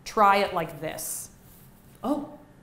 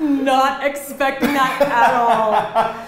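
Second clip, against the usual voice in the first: laughing; 5 semitones higher, 8 dB louder, much slower than usual. Real, not acted. Surprised by a gross result.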